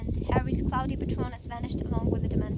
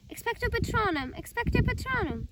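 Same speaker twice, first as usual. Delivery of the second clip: spell shout; 9 semitones higher than usual. Acted. Casting the spell.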